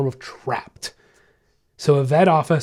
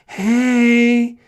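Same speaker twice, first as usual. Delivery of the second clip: imitating a nervous new vet tech, much higher and drawn out; ridiculous tone; 9 semitones higher, 5 dB louder, much slower than usual. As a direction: coo it slowly, high and sugary, like someone creeping toward a scared dog. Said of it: "using a ridiculous tone".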